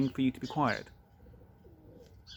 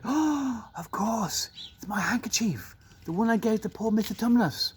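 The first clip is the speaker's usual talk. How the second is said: put-on surprised girl; 8 semitones higher than usual. Lucy recognizes her handkerchief.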